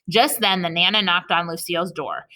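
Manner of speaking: plain reading voice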